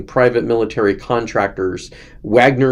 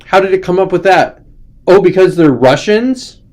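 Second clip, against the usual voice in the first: higher, louder, sarcastic wide-eyed wonder; mock amazement; 8 semitones higher, 6 dB louder than usual. Mocking critics about Russia war, loud sarcastic amazement. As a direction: ham up the fake shock at the obvious answer. Sarcastic crystal ball bit.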